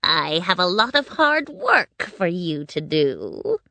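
witch voice with a swooping tune; wicked witch. A narrator's reading. Witch setting Dorothy to work, wicked and commanding. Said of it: "the witch said to Dorothy".

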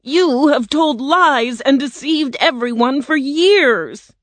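high, slow, indignant character voice; outraged, drawn-out denunciation; angry accusation. Acted. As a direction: denounce the fraud, stretching the key words. Tin Man angrily accuses the humbug.

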